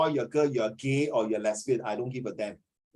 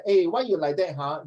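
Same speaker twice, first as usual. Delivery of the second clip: put-on Singaporean accent; 3 semitones higher than usual. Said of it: "if beside you this guy".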